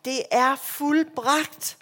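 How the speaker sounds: solemn call. Acted. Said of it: "havde han råbt".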